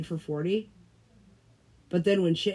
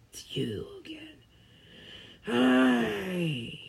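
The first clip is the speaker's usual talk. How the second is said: voiceless mock greeting; whisper; 3 semitones higher, much slower than usual. Greeting a friend, in a whisper. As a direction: breathe it, higher.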